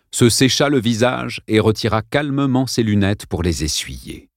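usual speaking voice

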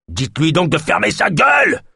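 high-pitched furious shout; angry roar. A narrator's reading. Man on the rock wants Yosp silenced, roaring. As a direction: roar the order from afar. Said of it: "rugit l'homme debout sur le rocher".

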